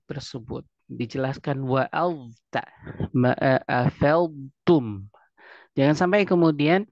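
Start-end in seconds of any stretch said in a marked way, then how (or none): 3.1-5.1 ma'afaltum very slow, every sound clear; clear articulation. Shown as a demonstration. Teacher modelling clear pronunciation for listeners. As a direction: spell out ma'afaltum slowly. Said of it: "harus dijelaskan".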